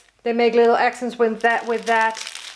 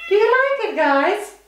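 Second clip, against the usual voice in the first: much higher, cooing question to the kittens; pet talk to cats; 9 semitones higher, 3 dB louder than usual. Real, not acted. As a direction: ask the cats sweetly, pitched way up. Asking the cats.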